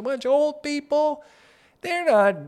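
high, loud mock outrage. Complaining about old people in his building, high and loud. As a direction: pitch up sharply in fake alarm.

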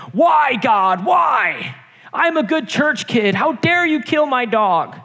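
put-on high, loud kid's cry of why, slowing at the end; yelling at God. Eight-year-old on the basement couch yelling at God, high and loud. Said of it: "I start yelling".

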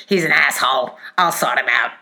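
low-pitched American boss impression; American accent. Bolshy company owner backs employee, imitated American accent. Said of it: "did it with an American accent".

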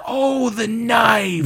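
high, drawn-out mock outburst; hammed-up audience shout; mock loud exclamation. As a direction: blurt it out slowly like an obnoxious moviegoer. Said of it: "she would say things out loud".

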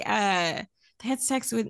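ordinary talk

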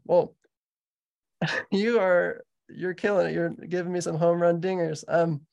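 ordinary delivery

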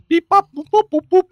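high, loud cartoon beeps; machine beeping imitation. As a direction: imitate a little lab machine beeping away.

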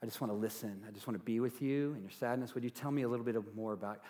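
soft, tender asking; gentle invitation. Shown as a demonstration. Pastor modelling a gentle question to someone crying. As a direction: coax them softly to share. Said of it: "a question".

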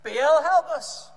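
loud, high drawn-out plea; acted cry for help to a god; calling out. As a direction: stretch it out and call high. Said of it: "they called out again".

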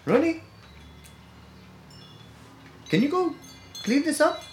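high-pitched mock mom voice; mom's voice. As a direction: call out like a sweet mom. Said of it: "she's like".

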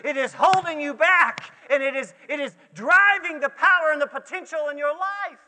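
loud, driving sermon delivery; full-force preaching. Real, not acted. Preacher warning the congregation what holds them back, full-force preaching.

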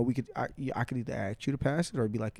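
normal talking voice

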